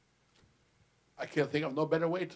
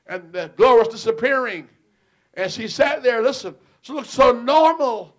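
high, loud preaching; preaching at full force; 6 semitones higher, 14 dB louder than usual. Acted. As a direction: lift the church with it. Preaching rises again.

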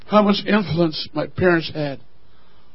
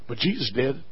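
ordinary talk, nothing marked

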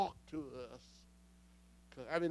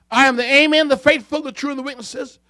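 raised, higher scripture declamation; proclaiming; 7 semitones higher, 9 dB louder, faster than usual. Acted. Preacher voicing God to Laodicea, proclaiming. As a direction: proclaim the titles with authority.

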